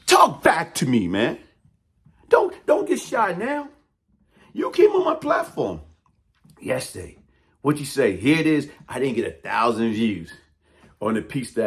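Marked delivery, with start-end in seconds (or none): 0.1-3.7 shouted taunt. Real, not acted. Daring the rival to respond, shouted taunt. 4.5-7.2 high, shouted accusation; shouting accusation. Real, not acted. Accusing the rival of coming on his platform, shouting.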